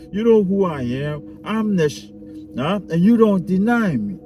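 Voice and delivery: hammed-up entitled narcissist. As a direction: lay on the diva demand, half laughing. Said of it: "I'm just having fun with this now".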